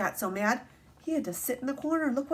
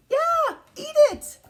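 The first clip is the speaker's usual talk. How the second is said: mock high squeal; 8 dB louder than usual. Mocking the book's silliness.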